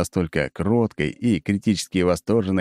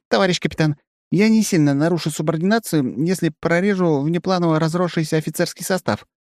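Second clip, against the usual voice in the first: put-on cautious young woman; 10 semitones higher than usual. A narrator's reading. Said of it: "на всякий случай уточняет она".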